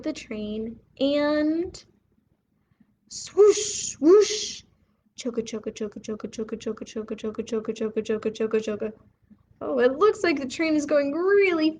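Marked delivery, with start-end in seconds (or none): 3.1-4.6 high, loud breathy swoosh; airy swooshing noise; whooshing train. Acted. Train sound effect. 5.2-8.9 soft, low rhythmic chugging; steady quiet train rhythm. Acted. Train sound effect.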